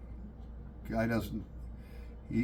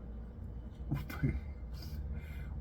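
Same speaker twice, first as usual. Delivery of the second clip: silent wheezing laugh; 2 semitones higher, 10 dB quieter than usual. Real, not acted. Laughing at his own story.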